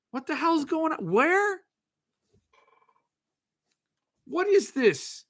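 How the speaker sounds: high-pitched mock bewilderment; baffled. Host acting out himself looking at a map, comically baffled. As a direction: squeak out the questions as if totally lost.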